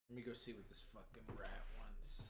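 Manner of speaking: breathy whispered aside, under the breath. Real, not acted. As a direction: mutter it to yourself in a whisper. Aside before checking on the cat.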